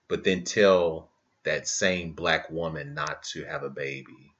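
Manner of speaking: ordinary talk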